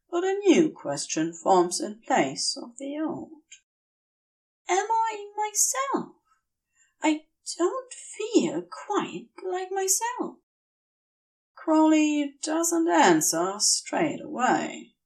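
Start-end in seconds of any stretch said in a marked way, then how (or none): 4.7-10.4 high, soft, slow, lost voice; fevered angel barely himself; feverish confusion. A narrator's reading. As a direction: sound dazed and far away. Feverish Aziraphale speaks.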